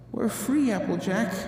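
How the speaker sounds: glowing with happiness; elated. Acted. Twilight tells Applejack they are free, softly elated. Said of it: "Twilight said with elation".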